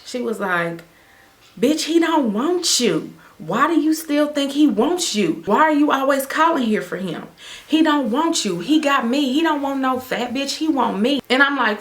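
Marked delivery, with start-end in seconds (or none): 1.6-11.2 put-on jealous girlfriend. New girlfriend answers ex's phone, high catty sneer. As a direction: sneer down at her, lifting the pitch.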